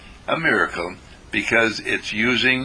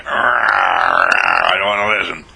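put-on slow, louder, sulky child voice; defiant child; 4 semitones lower, 5 dB louder, slower than usual. Young rascal refusing his father, slow louder sulky whine. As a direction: drag it out like a stubborn kid.

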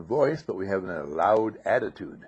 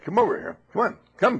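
high, loud, coaxing calls; loud call to dog; 9 semitones higher, 8 dB louder than usual. Retold or imitated. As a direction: holler at the dog to come. Hollering at the dog.

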